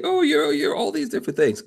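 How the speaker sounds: high mocking imitation of a doubter; sing-song jab at the know-it-all; mock critic voice. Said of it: "someone out there is gonna say".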